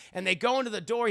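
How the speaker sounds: ordinary talk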